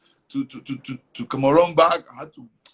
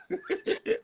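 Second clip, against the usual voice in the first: airy laugh; 4 dB quieter than usual. Real, not acted. Laughs at own joke.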